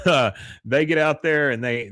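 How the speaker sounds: usual speaking voice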